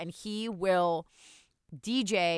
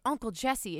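ordinary delivery

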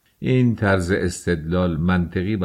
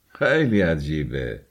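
ordinary delivery, nothing marked

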